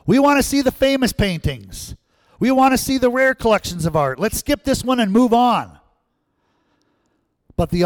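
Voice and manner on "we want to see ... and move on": loud, much higher shout from the crowd; shouted demand. Retold or imitated. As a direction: yell it from the back of the room. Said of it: "a voice in the back of the room shouted out".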